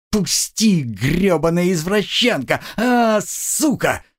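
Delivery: high, slow screaming curse; struggling scream. A narrator's reading. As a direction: scream high while fighting back.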